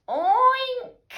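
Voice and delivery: very high loud oink; cats oinking. A narrator's reading. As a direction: squeal an oink. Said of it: "said the cats".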